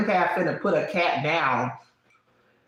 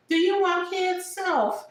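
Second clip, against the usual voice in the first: much higher playful self-questioning voice; questioning herself; slower than usual. Retold or imitated. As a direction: ask yourself in a mock sing-song. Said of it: "I check in with myself and I'm like".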